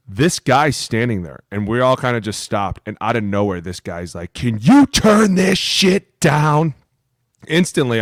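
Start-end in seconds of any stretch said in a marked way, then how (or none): 4.3-6.7 slow, loud, higher complaint from a grumpy stranger; annoyed stranger. Retold or imitated. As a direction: drag it out like an irritated man. Stranger complains about the music.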